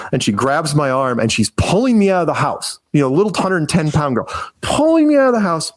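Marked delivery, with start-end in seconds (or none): 4.6-5.7 high, disbelieving retelling; a touch of shocked disbelief; mock astonishment. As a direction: sound amazed she could drag you. Small girl dragging him out.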